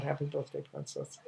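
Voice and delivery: trailing into a hushed whisper; tender whispered repeat of the father's greeting; soft whispered echo. Retold or imitated. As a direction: breathe it out, almost voiceless. Father's greeting echoed softly.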